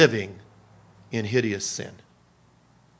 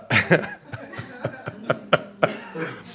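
breathy laugh. Real, not acted. Laughing at his own story.

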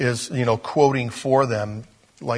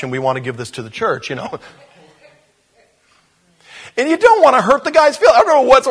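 ordinary talk

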